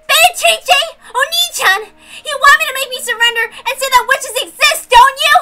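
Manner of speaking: tearful furious shouting. Acted. Angie screams at Beatrice, suspecting a trick, tearful shouting. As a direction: shout through tears, refusing to be fooled.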